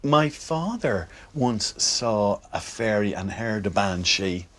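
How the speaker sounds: ordinary talk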